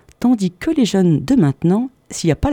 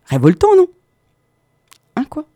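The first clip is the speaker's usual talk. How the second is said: mock outrage; 12 semitones higher, 4 dB louder than usual. Mock outrage at German song.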